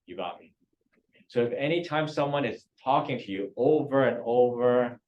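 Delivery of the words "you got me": soft. Retold or imitated. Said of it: "And they go".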